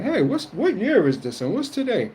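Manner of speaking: squeaky high confused mock voice; very high mock bewilderment; clueless newcomer. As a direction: play a lost mundane asking dumbly. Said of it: "you be like".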